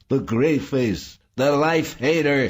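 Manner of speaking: high, loud hateful shriek. A narrator's reading. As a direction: shriek it as an accusation. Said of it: "Saul shrieked".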